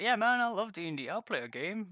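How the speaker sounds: mock eager agreement. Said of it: "I was like".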